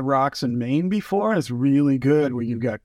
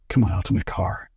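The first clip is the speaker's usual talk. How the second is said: lower, hushed invite; low conspiratorial guide voice; 7 semitones lower than usual. Retold or imitated. Retelling the fly guide waving him to his car, lowered conspiratorial voice. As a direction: drop the voice like a shady guide.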